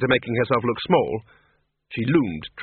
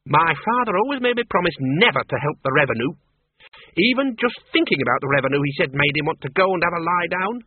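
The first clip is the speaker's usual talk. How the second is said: higher, brisk country woman ranting; indignant old farm woman; 4 semitones higher than usual. Acted. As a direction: scorn the taxman like a stubborn farmer's daughter. Miss Flitworth rants about taxmen.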